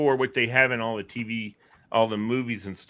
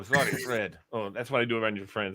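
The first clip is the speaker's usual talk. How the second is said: ordinary talk, nothing marked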